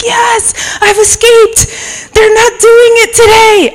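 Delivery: high, gleeful relief, slow on escaped; crowing that she got away; relieved glee. Retold or imitated. Preacher recalling her relief, high gleeful cry. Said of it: "I was like".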